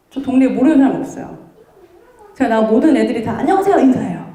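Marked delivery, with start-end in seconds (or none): 3.4-3.8 put-on quick, higher little-kid hello; child's greeting. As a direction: chirp it like a small child. Kids greeting her in street.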